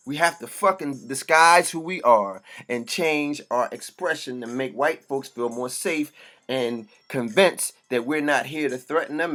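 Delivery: ordinary talk, nothing marked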